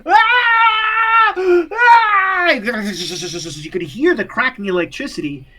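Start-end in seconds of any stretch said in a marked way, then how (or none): 0.1-2.5 high shrieking scream; woman's piercing electrocuted screams. Acted. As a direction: scream in terror, much higher and louder. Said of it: "the woman in the back screaming".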